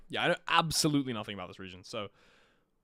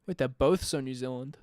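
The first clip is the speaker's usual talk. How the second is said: ordinary talk, nothing marked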